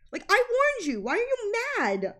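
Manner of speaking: high, loud exasperated protest; protest, baffled and annoyed; indignant. Real, not acted. Indignant retelling of argument.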